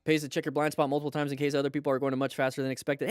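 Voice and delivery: rattled off quickly in one breath; fast reading. Real, not acted. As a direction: read it out fast, no pauses. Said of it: "Hey, I can read fast".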